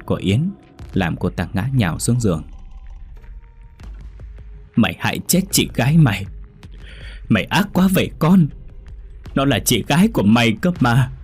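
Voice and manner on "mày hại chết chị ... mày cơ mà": furious accusation. Acted. Mother accuses daughter after slap.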